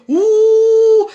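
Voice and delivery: loud drawn-out booing ouh; mock booing. Leading the girls in booing irresponsible men, loud ouh. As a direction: boo them like a jeering crowd.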